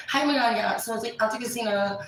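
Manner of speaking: mocking the friend's excited bragging; Amanda gushing. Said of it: "She'll be like".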